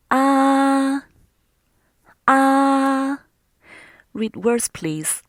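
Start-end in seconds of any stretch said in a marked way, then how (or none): 0.1-3.2 ah held long, high and level; high, flat tone. Shown as a demonstration. Teacher leading students in first-tone practice, ah high and flat. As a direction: hold each ah loud on one high note. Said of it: "The first tone is high and flat".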